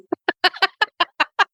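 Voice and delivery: laughing. Real, not acted. Laughing at [name] joke.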